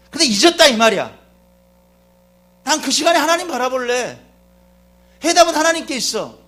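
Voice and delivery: loud, high-pitched sermon delivery; impassioned preaching. Real, not acted. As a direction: preach it at full force to the congregation. Preaches at full force.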